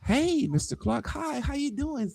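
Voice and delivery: high, soft, sweet mock greeting; dainty high-pitched woman's greeting, softly done; pretending parent. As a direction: imitate her putting on an innocent parent's hello. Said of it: "Acting like she a parent".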